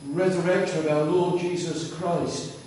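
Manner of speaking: usual speaking voice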